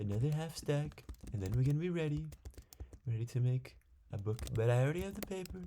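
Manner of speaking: put-on gamer hamming up a character accent about sugarcane; Big Donny T accent. Streamer doing the Big Donny T voice while farming sugarcane, high put-on accent. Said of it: "That's Big Donny T right there".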